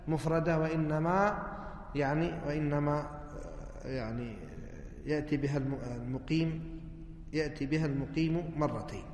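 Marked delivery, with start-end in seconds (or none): none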